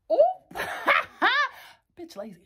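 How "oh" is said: high squealing laugh. Real, not acted.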